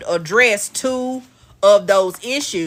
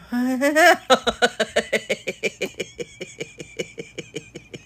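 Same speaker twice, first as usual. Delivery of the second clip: drawn-out laughing fit; helpless laughter at the chat; long laugh; 5 semitones higher, 3 dB quieter than usual. Real, not acted. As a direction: laugh hard for a while.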